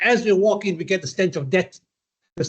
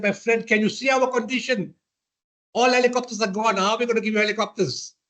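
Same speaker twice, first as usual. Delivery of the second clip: exasperated general; 7 semitones higher than usual. Retold or imitated. General pleads, raised pitch.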